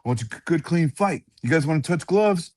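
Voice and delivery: high, rattled-off referee routine; referee's spiel. Retold or imitated. Referee instructs two fighters at the staredown, imitated spiel. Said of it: "he does his spiel".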